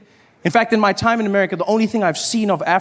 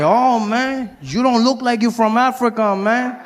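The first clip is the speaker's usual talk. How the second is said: put-on higher, accented doubting voice; 5 semitones higher, slower than usual. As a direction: doubt him like a friendly street buddy. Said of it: "He's like".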